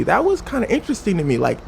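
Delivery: usual speaking voice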